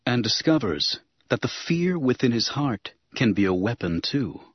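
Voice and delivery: plain reading voice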